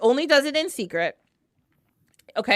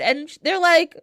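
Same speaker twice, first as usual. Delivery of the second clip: usual speaking voice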